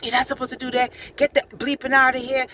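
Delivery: imitating a furious man telling her off; irate guard. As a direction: chase her off like an angry stranger. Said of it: "he was just irate".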